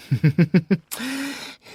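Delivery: a high, pleased chuckle; smug high laugh. Acted. Boasting to the officer about the SAS, high smug laugh. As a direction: laugh as if you've won already.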